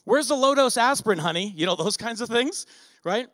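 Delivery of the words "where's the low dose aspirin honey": calling out across the house, louder and higher; half-joking shout to the spouse; mock yell. Ageing husband hollering to his wife for aspirin, mock yell. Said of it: "you start yelling out more often".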